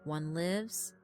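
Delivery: usual speaking voice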